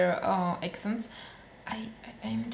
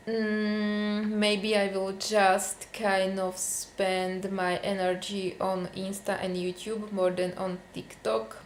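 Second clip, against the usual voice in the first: normal talking voice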